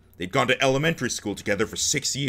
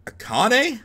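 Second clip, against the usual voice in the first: surprised recognition; 14 semitones higher, 5 dB louder than usual. Acted. Junpei recognizing old friend.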